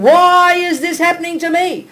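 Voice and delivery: high, loud, slow victim's lament; a mock wail of why me; self-pitying wail. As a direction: whine it like the world is against you. Quoting the sufferer's complaint.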